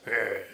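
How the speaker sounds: low, soft doggy growl; imitated dog growl. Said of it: "the dog just goes".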